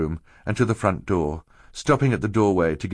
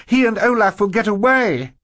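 high desperate shout; 13 semitones higher, 6 dB louder than usual. A narrator's reading. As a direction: yell in a panic. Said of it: "Violet shouted".

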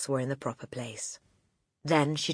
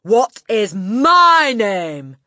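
drawn-out, high, loud bellow; bellowed demand; 7 semitones higher, 14 dB louder, much slower than usual. A narrator's reading. Headmistress testing the boy on her name, bellowed. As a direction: stretch it into a furious bellow.

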